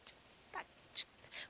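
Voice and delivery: whispered tic-tac; clock ticking. Shown as a demonstration. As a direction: tick it very softly. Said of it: "imita o barulho que os relógios fazem". (tique-taque sussurrado)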